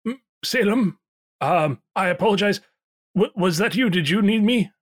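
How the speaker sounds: high, flustered call from the kitchen; flustered innkeeper. Acted. Pierre apologizing.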